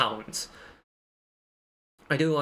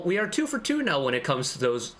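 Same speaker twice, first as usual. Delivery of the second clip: ordinary talk, nothing marked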